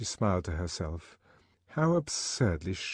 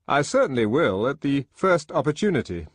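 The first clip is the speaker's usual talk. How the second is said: loud, high, hearty colleague; emphatic, raised character voice; eager agreement; 7 semitones higher, 7 dB louder than usual. A narrator's reading. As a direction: promise it with relish.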